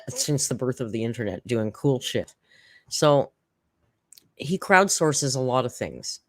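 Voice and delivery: normal talking voice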